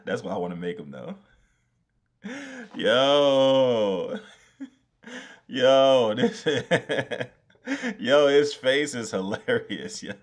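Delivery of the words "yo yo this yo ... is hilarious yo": loud laughing, words slowed; laughing hard. Real, not acted. Streamer laughing at the lemon's ugly face, loud laughter.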